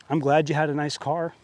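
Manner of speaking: ordinary delivery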